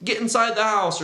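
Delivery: luring yell. Retold or imitated. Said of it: "So he yells to them".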